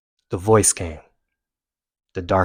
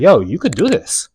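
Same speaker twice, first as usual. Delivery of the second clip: lifted, louder pep talk; encouraging inner voice; 6 semitones higher, 5 dB louder than usual. Retold or imitated. Runner's inner voice urging him on, encouraging. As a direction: cheer yourself on, pitched up. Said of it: "the voice kinda changed".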